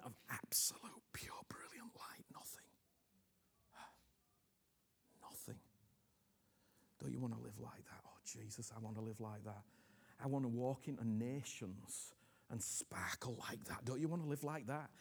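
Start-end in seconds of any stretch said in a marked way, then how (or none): 0.0-5.6 soft unvoiced whisper; fading whispered nothing. Real, not acted. Preacher drops to whisper. 7.0-13.8 soft, unvoiced appeal; whisper. Real, not acted.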